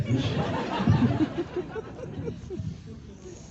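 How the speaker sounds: audience laughing; laughter from the crowd. Real, not acted. Audience laughing at the host's story.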